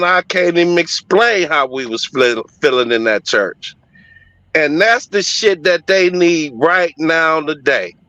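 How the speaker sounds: ordinary talk, nothing marked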